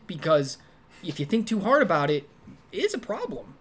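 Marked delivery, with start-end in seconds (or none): 2.7-3.6 high-pitched, soft aside; understated admission. Real, not acted. Understating, then intensifying the problem.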